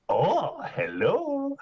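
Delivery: put-on very high, swooping Welsh accent; exaggerated Welsh sing-song greeting; attempted Welsh accent. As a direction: squeak up high in mock Welsh. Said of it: "amazing Welsh accent".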